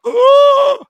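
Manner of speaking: huge, high mock gasp; exaggerated gasp of horror; shocked gasp. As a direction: suck in air in loud alarm. Navigator sees where they are.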